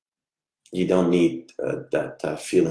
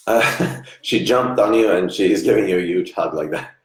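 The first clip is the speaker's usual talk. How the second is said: laughing, louder, caught off guard; surprised laughter; 3 semitones higher, 7 dB louder than usual. Real, not acted. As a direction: laugh it out after a real fright. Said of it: "it just took me by surprise".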